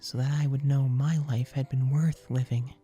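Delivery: put-on a girl saying a tender goodbye; young girl's farewell. Girl telling the boy she is at peace, wistful.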